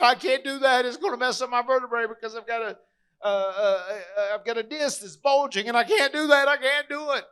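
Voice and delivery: high-pitched, mock-panicked protest; imitating a wrestler whining his way out; panicky refusal. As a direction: fret and refuse in a high, strained voice. Wrestler anxiously refusing the match.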